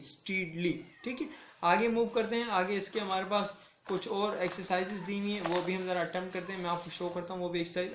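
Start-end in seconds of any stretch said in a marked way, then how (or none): none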